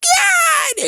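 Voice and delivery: very high, drawn-out scream; hammed-up high-pitched horrified wife; wife's shriek. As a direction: shriek it in mock horror, stretching each word. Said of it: "the freaking wife was like".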